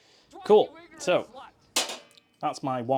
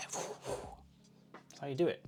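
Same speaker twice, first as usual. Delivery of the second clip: breathy whisper; 3 semitones higher, 8 dB quieter, much slower than usual. Pretend. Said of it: "making Action Hero noises".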